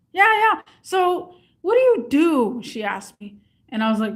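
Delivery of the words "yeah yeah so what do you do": high and loud, then slowing on the question; a pushy caller fishing for info; eager caller. Retold or imitated. Scammer pressing the narrator for details, high and loud.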